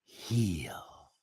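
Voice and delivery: breathy whisper of heal; whispered word. Shown as a demonstration. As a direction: whisper heal softly, savouring it. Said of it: "Just feel the word".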